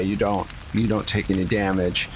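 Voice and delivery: ordinary talk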